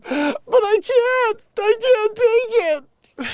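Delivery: very high, loud mock wail; gasp, then wail it in a squeaky, drawn-out cry; hammed-up crying. Comedian as crying driver to the cop, hammed-up wailing. Acting Gisele's crying fit.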